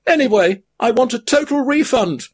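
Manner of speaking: high weary hobbit voice; a high-pitched hobbit worn out by his burden; weary Frodo. A narrator's reading. Frodo's held figure voice.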